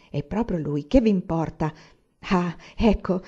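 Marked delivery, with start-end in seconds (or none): none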